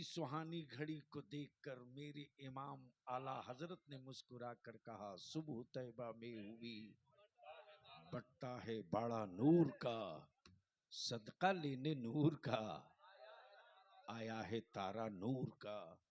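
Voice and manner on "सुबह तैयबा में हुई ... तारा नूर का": low, measured verse with drawn-out line endings; devotional verse recitation. Real, not acted. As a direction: recite the couplet to the congregation, pausing between phrases. (नात का शेर ठहर-ठहर कर पढ़ते हुए)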